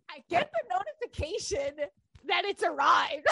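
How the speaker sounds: usual speaking voice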